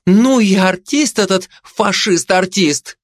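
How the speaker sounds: high, drawn-out marvelling; high-voiced busybody amazement; exclaiming gossip. A narrator's reading. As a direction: marvel at it, stretching the words. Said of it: "воскликнула сплетница".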